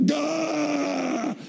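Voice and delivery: sudden high yelp of alarm; panicked yelp. Retold or imitated. Husband realizing he overslept, a high panicked yelp. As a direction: yelp like you just saw the clock.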